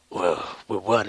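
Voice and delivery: a hint of deadpan Batman. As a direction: say it low and skeptical. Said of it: "it's like".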